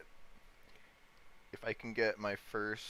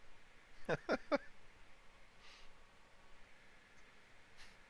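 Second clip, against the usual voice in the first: giggling laugh; 5 dB quieter than usual. Real, not acted. Laughing after the bit.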